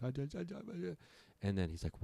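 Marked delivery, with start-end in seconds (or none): 0.0-1.0 soft, high, garbled stammer; imitated mumbling. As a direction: act too shaken to talk, mumbling quietly. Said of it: "I was just mumbling".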